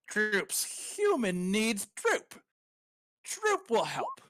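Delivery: high, slow, squeaky goblin pledge; eager goblin Droop. Acted. Goblin Droop to the player, high eager pledge. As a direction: squeak out a proud promise.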